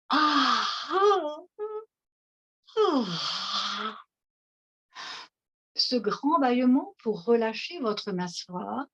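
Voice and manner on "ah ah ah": demo yawn. Shown as a demonstration. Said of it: "Puis accueillez un grand bâillement".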